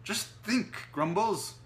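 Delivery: high, loud exasperated outburst; exploding. A narrator's reading. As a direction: explode with impatience. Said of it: "Otto exploded".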